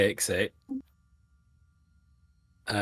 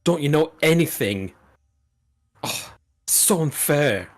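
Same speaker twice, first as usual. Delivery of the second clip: put-on huffing teenager; 6 semitones higher than usual. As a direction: huff and puff like a sulky teen. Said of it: "like Kevin and Perry just huffing and puffing".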